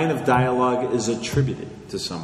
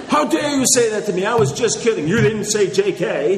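much higher, louder mock-indignant online ranter; mock outrage; 9 semitones higher, 4 dB louder than usual. Forum poster lashing back at a joke, hammed-up outrage. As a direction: play a touchy forum poster taking offense, much higher. Acting out an online flame war.

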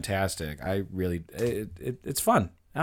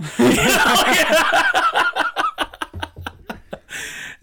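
long high-pitched fit of laughter; 5 dB louder than usual. Real, not acted. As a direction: burst into giggles. Cracking up.

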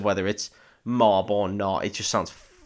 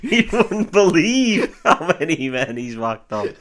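laughing repeat; 8 semitones higher, 7 dB louder than usual. Real, not acted. Friend echoing the funny line, laughing. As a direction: quote it through laughter, higher and louder.

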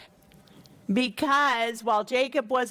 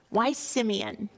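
normal talking voice

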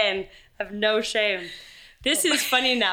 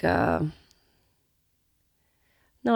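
ordinary talk

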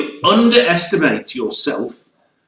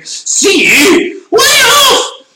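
high cry rising to a louder shriek; hammed-up screaming over imagined crashing; mock dramatic wail; 4 dB louder, much slower than usual. Someone shouting amid radio drama crashes, high mock wail. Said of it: "someone saying".

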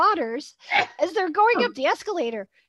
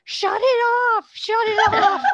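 higher, louder mock yell, half laughing; retold screaming; 9 semitones higher, 6 dB louder than usual. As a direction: playfully yell the crowd's plea to stop it. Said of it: "people at the top start screaming".

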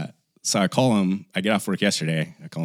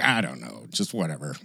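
imitating dad's mutter; 4 semitones lower, 6 dB quieter than usual. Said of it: "they start muttering".

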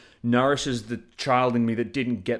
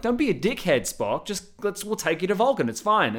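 higher, mock-pleading crew; hammed-up crew, pitched up high; exasperated crewmates; 8 semitones higher, 3 dB louder than usual. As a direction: squeak it out like exasperated friends. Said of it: "And they're like".

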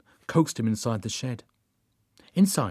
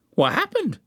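very high-pitched Derek voice; Derek, puzzled. A narrator's reading. Derek asks Joan why the ritual failed, puzzled. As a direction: pitch it right up as a baffled sidekick.

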